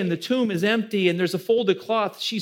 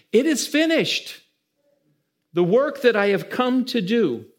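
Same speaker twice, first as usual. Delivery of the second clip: proclaimed; 5 semitones higher, 4 dB louder than usual. Acted. Jesus's words proclaimed in sermon.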